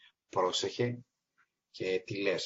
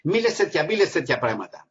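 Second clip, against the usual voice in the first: joking reply; 6 semitones higher, 5 dB louder than usual. Retold or imitated. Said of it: "λέω".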